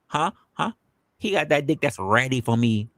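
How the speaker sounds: usual speaking voice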